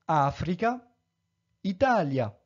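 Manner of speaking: "Africa, Italia" as A-fri-ca, i-TA-li-a; third-last stress. Shown as a demonstration. Teacher giving examples, "Africa, Italia" stressed on third-last syllable. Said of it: "accentus in tertia syllaba".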